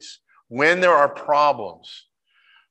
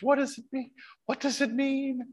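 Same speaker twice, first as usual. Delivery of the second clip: higher, softer, awed hush; whispering; 12 semitones higher, 7 dB quieter than usual. Retold or imitated. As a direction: breathe the question in wonder. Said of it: "particularly when he whispers".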